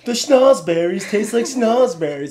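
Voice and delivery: put-on high, loud teasing English accent; mock English accent. Cohost teasing that the accent sounds English, mock accent. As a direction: parody an English film-character accent. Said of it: "That's what I'm hearing".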